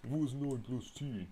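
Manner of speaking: high, slow dopey question; lightly put-on dumb voice; mock dim voice. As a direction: ask it like a clueless oaf.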